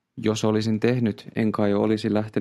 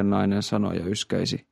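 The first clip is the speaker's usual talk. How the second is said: plain reading voice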